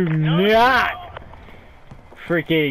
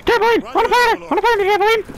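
very high, excited squeal; giddy excitement; 4 dB louder, faster than usual. Pretend. Silly excitement over a trampoline.

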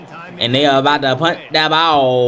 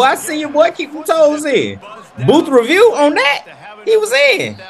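high, laughing hype; excited cheering; 11 semitones higher than usual. Real, not acted. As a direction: hype up the sideline catch. Cheering a toe-tap catch.

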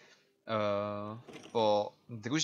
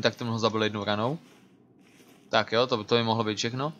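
ordinary talk

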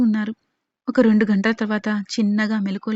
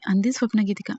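ordinary delivery

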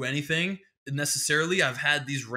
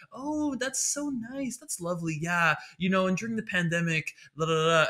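hammed-up chirpy small talker; small talk stranger; 5 semitones higher than usual. Comic mocking a stranger's small talk, high and sugary. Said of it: "people will just be like".

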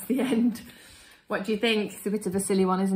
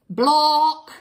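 a sudden loud silly word; nonsense word blurted; 6 semitones higher, 11 dB louder than usual. Acted. Reader forced to say silly word, loud blurt.

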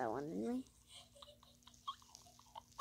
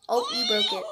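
laughing; 5 dB louder, faster than usual. Real, not acted. Laughing at the break.

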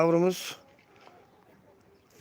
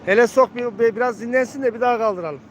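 calling out; 5 semitones higher, 3 dB louder than usual. Real, not acted. Calling out to the handler.

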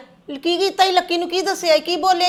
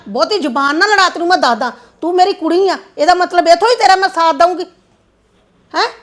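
loud, sharp telling-off; angry scolding; 3 semitones higher, 4 dB louder than usual. Acted. Mother loudly scolding her daughter-in-law to stop talking back. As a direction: shut her up with a raised voice.

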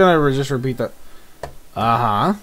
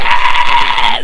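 scream into giggles; 4 dB louder than usual. Real, not acted. Cheering at announcement.

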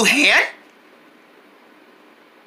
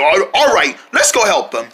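normal talking voice

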